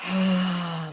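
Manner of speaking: soft exhale of letting go; releasing sigh. Retold or imitated. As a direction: sigh out as if relaxing a clenched muscle. Acts out releasing the clenched muscle.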